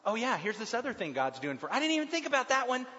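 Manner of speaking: suddenly realizing how good God is; amazed realization. Retold or imitated. Bible study leader acting out a reader's delighted discovery, excited. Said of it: "we find".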